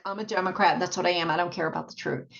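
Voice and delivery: ordinary delivery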